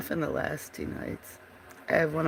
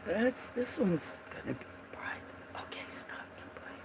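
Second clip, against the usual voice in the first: whisper; 2 semitones higher, 14 dB quieter than usual. Real, not acted. Muttering to herself.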